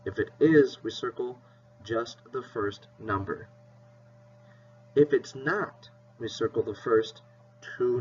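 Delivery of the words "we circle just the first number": soft repeat. Shown as a demonstration. Teacher softly repeating the rule to her class. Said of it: "teacher repeats the rule softer".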